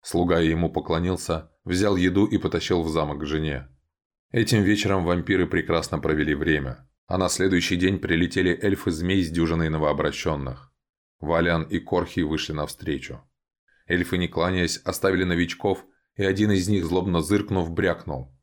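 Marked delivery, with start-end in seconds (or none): none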